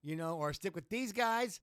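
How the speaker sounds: high, loud wrestler ultimatum; Piper ultimatum. Retold or imitated. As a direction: lean on him like a jilted partner. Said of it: "where he's like".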